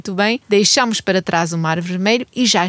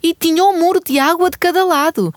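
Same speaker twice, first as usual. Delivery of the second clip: excited awe; 9 semitones higher, 3 dB louder than usual. Acted. Marvelling at the sea.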